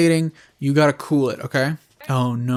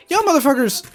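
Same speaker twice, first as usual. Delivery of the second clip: exasperated rant; 11 semitones higher than usual. Real, not acted. Ranting at the movie's critics.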